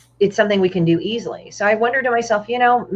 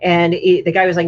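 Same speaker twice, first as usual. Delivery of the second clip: ordinary talk, nothing marked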